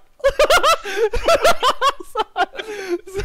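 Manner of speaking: high loud laughing fit; loud burst of laughter. Real, not acted. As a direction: crack up loudly. Enemy walks right past.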